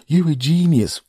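high, loud mimicked praise; mock gushing fan. Host imitating fans who praise him, mock gushing. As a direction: mimic an overexcited admirer.

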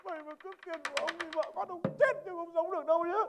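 furious yell. Acted. Passenger yells at the knocked-out robber, furious and loud.

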